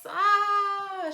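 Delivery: high, loud acted scream; sudden loud "ah" of a tantrum; storybook scream. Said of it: "She screams".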